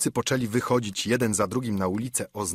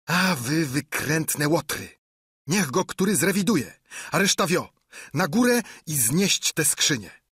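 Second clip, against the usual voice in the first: scolding; 6 semitones higher than usual. A narrator's reading. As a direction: tear into the lazy crew. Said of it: "Ślepiec znów ich złajał". (ślepiec łaje zbójców, wściekle)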